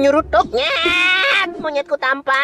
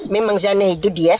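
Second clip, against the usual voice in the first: usual speaking voice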